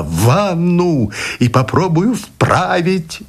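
высоко, медленно, зловеще тянет слова; страх поёт демонским голосом, нараспев; демонический голос. A narrator's reading. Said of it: "демонским голосом пел страх". (демонский напев)

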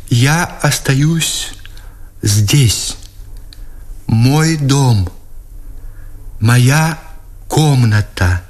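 high, slow, word by word; drawn-out speech. A narrator's reading. Emma pleads with the lieutenant in broken grammar, drawn-out. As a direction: stretch each word out with long pauses. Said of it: "протяжно заговорила Эмма".

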